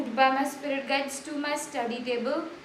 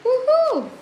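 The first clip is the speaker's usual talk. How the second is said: high, loud delighted cheer; excited whoop; 10 semitones higher, 7 dB louder than usual. Real, not acted. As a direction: whoop with joy at the reveal. Shows off best painting.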